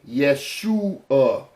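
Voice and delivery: "Yeshua" slowly split as Yesh-oo-uh; syllable by syllable. Shown as a demonstration. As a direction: draw out each part of "Yeshua". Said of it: "there are three sounds combined as one".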